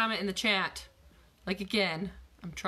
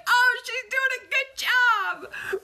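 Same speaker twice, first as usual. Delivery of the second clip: much higher, louder and slow, sing-song; mock sweet reply; 10 dB louder, slower than usual. Trainer replaying her cheeky answer in the gym, mock sweet sing-song. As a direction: play it innocent and sugary. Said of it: "I was like".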